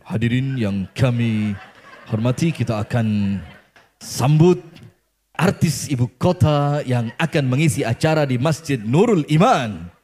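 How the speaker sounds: low, swelling stage announcer impression; bassy dangdut MC. Dandy imitating a dangdut MC welcoming guests, bassy announcer voice. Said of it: "ngomongnya ngebas kayak M C dangdut".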